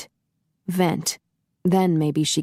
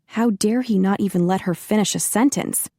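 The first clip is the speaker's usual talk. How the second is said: plain reading voice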